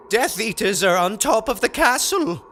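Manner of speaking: quivering bleat. A narrator's reading. Ron warns his friends about Death Eaters, quivering bleat. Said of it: "Ron bleated, quivering".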